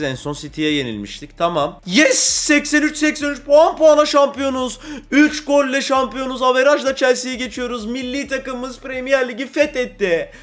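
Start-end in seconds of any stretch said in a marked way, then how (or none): 1.9-10.3 loud, high, overjoyed cheering; victory shout. Real, not acted. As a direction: shout the title win with real joy. Şampiyonluk anında sevinç çığlığı. (coşkuyla bağırarak zafer sevinci)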